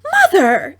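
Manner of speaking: high, loud shocked outcry; shocked cry. A narrator's reading. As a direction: cry out in horror. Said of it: "Amethyst looked shocked".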